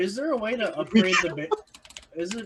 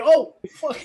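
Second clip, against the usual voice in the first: surprised; 8 semitones higher, 9 dB louder than usual. Pretend. Player caught smoking.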